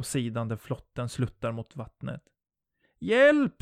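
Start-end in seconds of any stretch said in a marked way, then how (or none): 3.0-3.6 very high, loud cry for help; scream for help. A narrator's reading. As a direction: scream desperately for rescue. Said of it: "skriker jag". (desperat skrik på hjälp)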